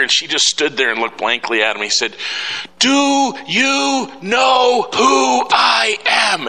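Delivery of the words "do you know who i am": very slow, much higher shout; loud angry shout. Retold or imitated. Man yelling at the airline counter, loud and slow. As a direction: drag out each word in outrage. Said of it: "he was so loud".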